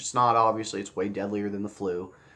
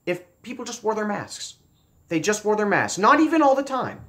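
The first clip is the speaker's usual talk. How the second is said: emphatic insistence; 9 semitones higher, 5 dB louder than usual. Real, not acted. Insisting on wearing masks.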